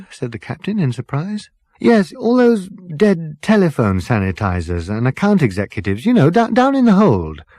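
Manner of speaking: lightly voiced Ford, casually probing; Ford, polite and curious. Ford politely asking the captain about bodies, lightly voiced.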